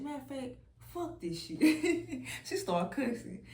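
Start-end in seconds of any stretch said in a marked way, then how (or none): 1.7-2.4 loud high laugh; real laugh bursting out; burst of laughter. Real, not acted. Laughing at the cussing.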